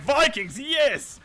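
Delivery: loud cheer leaping high; excited fans. Retold or imitated. Viewers spotting the Vikings on the field, excited shout. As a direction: imitate a crowd thrilled to see them. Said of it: "Everyone's like".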